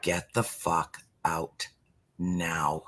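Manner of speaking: low, soft and slow, each word weighed; quiet banishing mantra. Shown as a demonstration. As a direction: hush it down to a grim, slow command. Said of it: "that's all I have to say".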